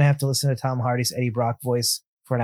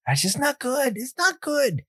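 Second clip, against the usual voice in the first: high disgusted whine; 10 semitones higher, 3 dB quieter, slower than usual. Real, not acted. Host complaining about Hardy's Brooklyn accent, high disgusted whine. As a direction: pitch jumps way up in exasperated disgust.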